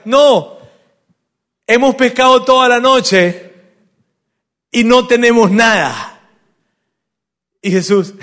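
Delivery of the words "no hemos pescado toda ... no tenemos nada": high, loud, drawn-out reply; shouting back. Acted. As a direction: holler the answer across the water, slowly. Fishermen shouting back across water. (respuesta a gritos, lenta y aguda)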